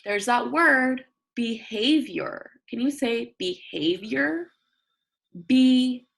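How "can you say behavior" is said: ordinary talk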